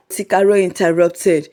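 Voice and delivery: ordinary delivery, nothing marked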